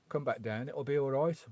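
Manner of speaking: ordinary delivery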